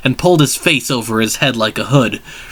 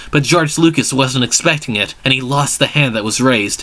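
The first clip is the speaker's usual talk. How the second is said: ordinary delivery, nothing marked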